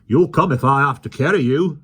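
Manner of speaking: loud, high threat; threatening policeman. A narrator's reading. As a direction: menace them with force.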